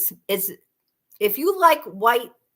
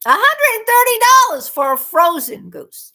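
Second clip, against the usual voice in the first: high, loud disbelief at the price; incredulous outburst; 10 semitones higher, 10 dB louder than usual. Real, not acted. Streamer telling viewers the price of a goose, incredulous. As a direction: exclaim it, scandalized by the cost.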